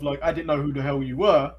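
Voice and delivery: ordinary delivery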